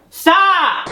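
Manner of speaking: very high, loud shout; real shrill command at pets; piercing yell. Real, not acted. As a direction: shriek at the cats to stop. Yelling at cats.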